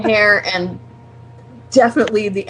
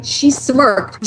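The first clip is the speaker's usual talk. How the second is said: ordinary delivery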